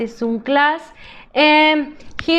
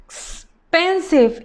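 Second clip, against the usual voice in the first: slowly sounded out; 3 semitones higher, slower than usual. Shown as a demonstration. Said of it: "teacher sounds out word".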